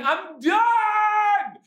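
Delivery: put-on high, loud childish yell; retold yell. Exhausted dad yelling ahead to his wife. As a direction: throw up your hands and shout you're done. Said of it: "And I'm yelling".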